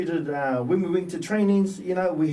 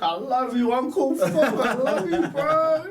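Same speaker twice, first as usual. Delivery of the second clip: much higher, laughing through tears; laughing-crying thanks; 10 semitones higher, 4 dB louder, slower than usual. Retold or imitated. Norm hugging the coach, laughing and crying. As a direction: gush it while hugging the coach. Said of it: "Norm Berryman running up to our".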